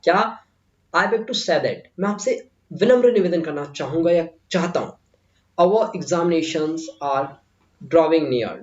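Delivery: ordinary talk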